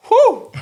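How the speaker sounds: very high whistling whoosh; whoosh of speed. Retold or imitated. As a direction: mimic something zipping past. Imitating how fast the spider moved.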